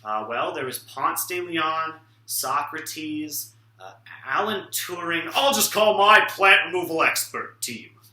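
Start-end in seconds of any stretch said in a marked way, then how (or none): none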